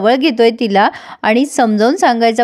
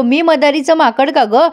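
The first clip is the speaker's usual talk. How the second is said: plain reading voice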